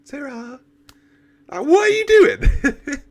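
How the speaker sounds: high, slow, laughing dopey mock Doctor. Mocking the Doctor staring at the broken window, high and slow. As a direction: ham up a clueless Doctor through a laugh. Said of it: "he's just like".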